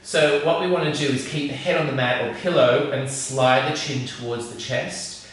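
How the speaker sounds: ordinary talk, nothing marked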